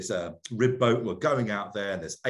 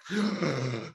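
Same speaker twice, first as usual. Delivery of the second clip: a sharp imitated intake of breath; mock panicked gasp; 4 semitones higher than usual. Diver showing how he gasped at the shark, sharp mock gasp. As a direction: gasp as if a shark lunges at you. Shark comes at his mouth.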